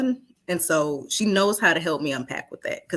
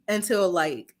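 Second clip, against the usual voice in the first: normal talking voice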